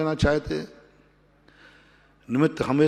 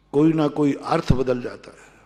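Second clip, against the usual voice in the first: ordinary talk, nothing marked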